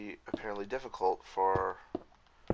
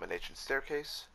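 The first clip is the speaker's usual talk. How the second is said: ordinary talk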